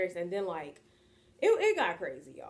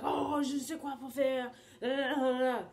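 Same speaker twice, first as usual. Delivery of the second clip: mock freak-out; 6 semitones higher than usual. Au pair mimics host family's crazy reaction to sick day, mock freak-out. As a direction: mimic their overblown fuss in French. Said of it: "they went crazy".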